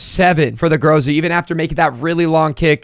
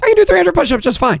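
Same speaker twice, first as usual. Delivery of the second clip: higher and fast, "do" punched in mock confidence; forced bravado; 11 semitones higher, faster than usual. Real, not acted. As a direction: pump yourself up defiantly. Bravado repeat.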